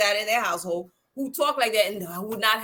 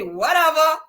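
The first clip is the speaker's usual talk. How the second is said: louder, higher dismissive whatever; a kid talking back to the lady; sassy whatever; 7 semitones higher, 6 dB louder than usual. Retold or imitated. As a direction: toss it off with attitude. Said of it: "gave her attitude back".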